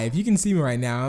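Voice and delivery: ordinary delivery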